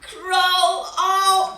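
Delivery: ordinary delivery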